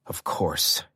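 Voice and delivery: soft, breathy, voiceless mutter. Acted. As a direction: mutter it under your breath. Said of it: "he muttered".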